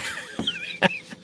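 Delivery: a sudden high-pitched giggle; short high giggle, caught off guard; high squeaky laugh. Real, not acted. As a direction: burst into a squeaky laugh at the joke. Laughs at viewer's furry name.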